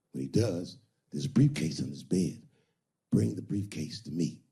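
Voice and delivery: low, smooth pimp voice laying out the plan. Retold or imitated. Iceberg Slim instructing her on the trick, low and smooth. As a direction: talk low and cool, like you own her. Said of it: "He said".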